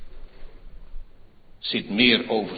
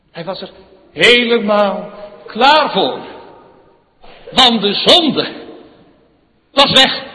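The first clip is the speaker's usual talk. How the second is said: Eén woord loud and higher; 17 dB louder than usual. Acted. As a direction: proclaim Eén woord at full force. Preaching at full force. (luid en hoog uitgeroepen)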